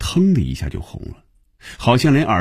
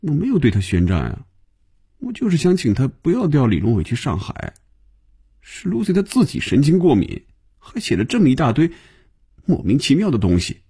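higher-pitched, put-upon grumble; grumbling; 6 semitones higher than usual. A narrator's reading. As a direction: grumble in self-defence, pitched up. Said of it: "菲比嘴里嘟囔着".

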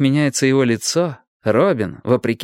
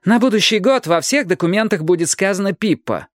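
high, defiant announcement; raised-pitch proud declaration; declaring; 9 semitones higher than usual. A narrator's reading. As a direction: proclaim it stubbornly. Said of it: "объявила она".

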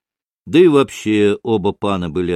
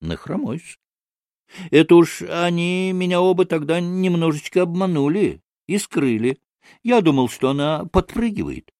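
higher, plaintively comic confession; ingratiating storyteller figure; 8 semitones higher than usual. Acted. Maksimov confesses he was fooled by his bride, ingratiating. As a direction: admit it obligingly, pitch floating high.

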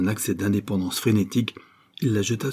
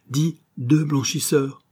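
higher, drawn-out announcement; abrupt decision; 6 semitones higher, slower than usual. A narrator's reading. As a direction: read as a sudden, firm decision. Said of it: "dit-il brusquement". (ton brusque et décidé)

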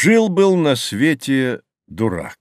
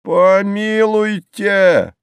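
long, high, loud outcry; very drawn-out indignant exclamation; exclaiming; 9 semitones higher, 5 dB louder, much slower than usual. A narrator's reading. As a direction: stretch it out in scandalized protest. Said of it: "воскликнул дурак".

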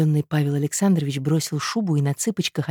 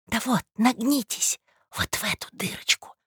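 conspiratorial whisper; 8 semitones higher, 4 dB quieter than usual. Acted. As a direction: guide him secretly to the peephole. Secretly directing him to the peephole.

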